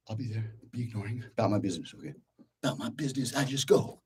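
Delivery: quiet run-through. Shown as a demonstration.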